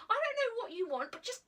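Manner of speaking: high, loud furious threat; boy threatening to call the police, furious; angry shouting. Acted. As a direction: shout her away with threats. Boy shouting threats while leaving.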